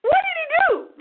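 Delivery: very high, sweetly friendly question; nice and bubbly. Retold or imitated. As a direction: ask nicely in a high, bubbly voice. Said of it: "I said it in a nice way".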